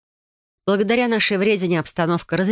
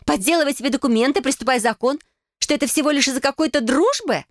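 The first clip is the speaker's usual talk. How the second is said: higher-pitched, indignant challenge; agitated sister; 8 semitones higher than usual. Acted. Sister confronting Adele about the family friend, indignant. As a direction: voice a hurt sister pressing hard, pitched up.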